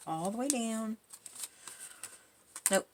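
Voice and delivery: ordinary talk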